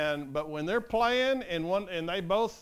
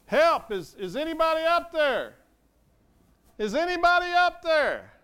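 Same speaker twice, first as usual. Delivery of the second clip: yelling for help; 10 semitones higher, 7 dB louder than usual. Acted. Man clinging to a shrub on a cliff, yelling for help. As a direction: holler up from far below. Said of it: "to yell".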